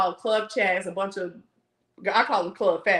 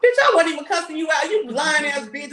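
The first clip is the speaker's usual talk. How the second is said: louder, indignant snap; angry retort; 5 semitones higher, 4 dB louder than usual. Real, not acted. Cursing out Nika over her lie, angry retort. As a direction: snap back in outrage.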